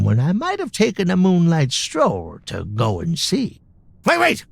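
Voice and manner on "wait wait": high, startled double wait; sudden alarm. Acted. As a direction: catch yourself in a panic. Storekeeper suddenly stops himself.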